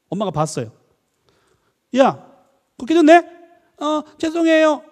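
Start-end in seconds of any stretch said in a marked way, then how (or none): 2.0-3.2 high, loud startled call; acted parental exclamation. Mother sees child broke a bottle, loud exclaiming. 3.8-4.8 put-on high, slow little-kid apology; apologetic child. Child apologises to mother for breakage, high drawn-out plea.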